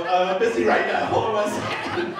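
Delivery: loud, high strained shout; comic frantic stalling; harried brush-off. Retold or imitated. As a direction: call out mid-wrestle to stall the caller. Answering phone mid-wrestle.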